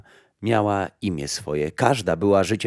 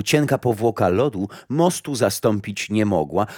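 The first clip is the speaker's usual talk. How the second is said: plain reading voice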